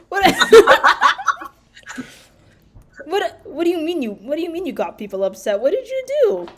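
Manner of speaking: stammered start, pitch leaping up on the last question; incredulous questioning. Acted. Traveler hears Azure upset the locals, incredulous questioning. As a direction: demand an explanation in disbelief.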